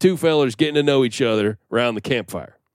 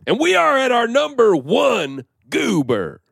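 announcer voice; 9 semitones higher, slower than usual. Acted. Show title read dramatically.

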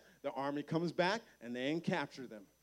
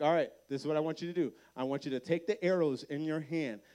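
quick prophet instructions; 5 dB louder, faster than usual. Retold or imitated. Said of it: "Elisha's like".

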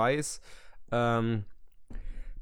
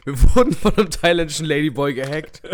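loud; 3 semitones higher, 11 dB louder than usual. Acted. Loud mock confession.

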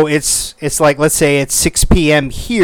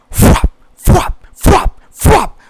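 flapping noise; much slower than usual. Retold or imitated. Imitating wings flapping outside window.

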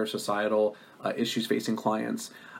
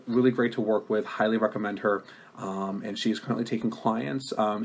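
ordinary delivery

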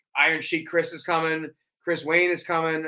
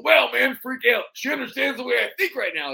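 pitched-up wrestling promo; Macho Man impression; 6 semitones higher than usual. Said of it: "like, his real voice".

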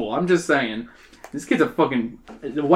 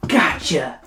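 understated triumphant exclamation; a touch of satisfaction, without really yelling; satisfied mild yell. A narrator's reading. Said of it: "she yells with satisfaction".

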